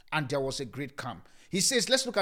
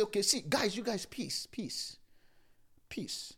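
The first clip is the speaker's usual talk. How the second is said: softer and softer soothing peace; calming hush; 5 semitones higher, 4 dB quieter, slower than usual. Acted. Jesus calming panicked disciples, fading soft reassurance. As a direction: hush them gently.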